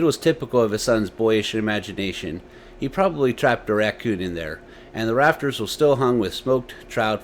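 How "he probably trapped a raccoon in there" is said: usual speaking voice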